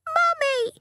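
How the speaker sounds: put-on very high little child's cry; pleading toddler. A narrator's reading. Toddler clasping her mother tightly, high-pitched plea. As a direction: call out for mummy like a toddler.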